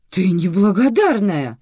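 loud, high, drawn-out accusation; indignant exclamation. A narrator's reading. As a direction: cry it out, stretching the words. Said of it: "воскликнула Светлана Степановна".

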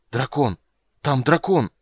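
high, loud, urgent outburst; alarmed cries. Acted. Shoemaker warns the hunters of a dragon, alarmed. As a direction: blurt out the danger, rising in pitch. Breathless messenger reports a dragon.

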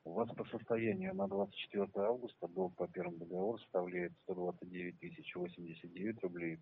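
ordinary talk

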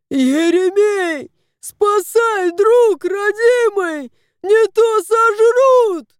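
building to a high, loud shout; slow, yowling cry for help; desperate yowl. A narrator's reading. Cat calls his friend for rescue, yowling. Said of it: "мяукнул".

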